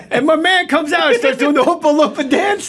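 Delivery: high-pitched, breaking into laughter; excited raving. Real, not acted. Guest raving about Hugh Grant's dance scene, thrilled. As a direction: gush at the top of your range, laughing.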